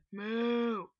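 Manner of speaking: long, loud mock moo; loud imitated cow moo. Player answering a cow heard nearby, loud imitated moo.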